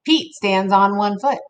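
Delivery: usual speaking voice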